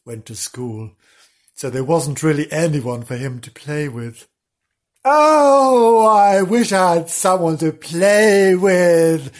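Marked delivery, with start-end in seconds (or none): 5.0-9.3 high, loud, drawn-out wail of longing; wistful sighing. Acted. Lonely pup wishing for a playmate, theatrical sighing. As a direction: sigh it out slowly, theatrically. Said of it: "he kept sighing".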